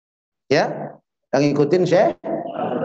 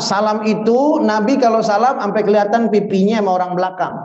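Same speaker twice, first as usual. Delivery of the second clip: ordinary talk, nothing marked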